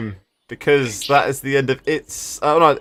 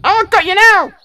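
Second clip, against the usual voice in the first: high, squeaky gleeful goblin; 9 dB louder than usual. Acted. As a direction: crow it like a cocky little goblin. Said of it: "That goblin voice makes my heart melt".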